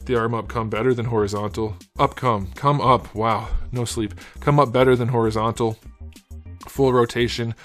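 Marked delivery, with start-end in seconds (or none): none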